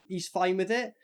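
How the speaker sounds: ordinary talk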